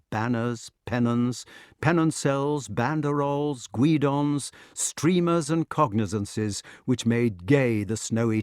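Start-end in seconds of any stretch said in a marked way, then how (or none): none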